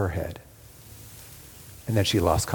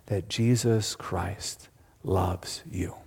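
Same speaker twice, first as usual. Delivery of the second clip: slow, hushed whisper of reassurance; 2 semitones lower, 5 dB quieter, much slower than usual. Real, not acted. Preacher assures the listener of Christ's love, in a slow whisper. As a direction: lean in and whisper it slowly. Preacher drops to intimate appeal.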